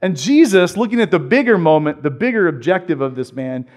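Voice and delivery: ordinary talk, nothing marked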